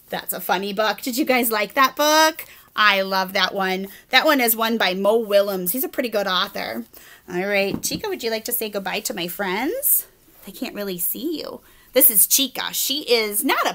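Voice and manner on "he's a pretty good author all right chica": ordinary delivery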